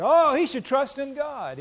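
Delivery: high, loud sneering taunt; mocking jeer. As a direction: scoff at the crucified man. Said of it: "they did mock Jesus".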